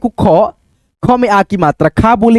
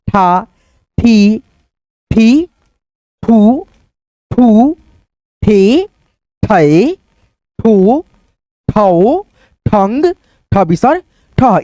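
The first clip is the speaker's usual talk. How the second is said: each syllable said alone with a short pause after it; syllable recitation; much slower than usual. Shown as a demonstration. Teacher chanting the barakhadi to students, one syllable at a time. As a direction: recite slowly for children to repeat.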